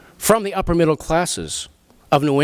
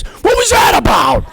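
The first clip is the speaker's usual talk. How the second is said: high, loud mock-incredulous shout; drawn-out, high-pitched yell of disbelief; baffled outburst; 7 dB louder than usual. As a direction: belt out the question in comic bewilderment. Acting out baffled family question.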